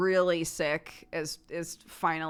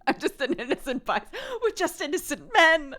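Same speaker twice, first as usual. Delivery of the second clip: high-pitched, laughing protest of innocence; giggly, hammed-up pleading; laughing mock plea; 7 semitones higher than usual. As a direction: plead innocence while cracking up.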